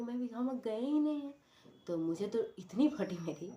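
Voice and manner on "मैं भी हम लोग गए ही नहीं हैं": imitating friend's denial. Friends deny leaving, voiced quote.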